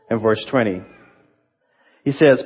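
ordinary talk, nothing marked

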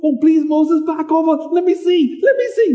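imitated plea, pitch leaping up at the end; pleading Joshua. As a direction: beg like an excited kid wanting a look. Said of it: "Can you imagine Joshua?".

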